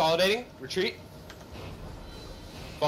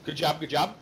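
ordinary talk, nothing marked

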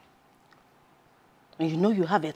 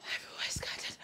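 whispered, shocked muttering; barely voiced, troubled murmur; distressed whisper; 13 dB quieter than usual.

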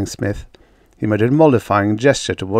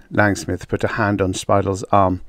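ordinary delivery, nothing marked

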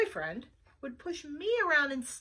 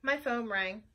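ordinary delivery

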